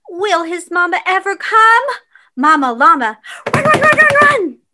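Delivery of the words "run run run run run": urgent. A narrator's reading. Urging mama to hurry.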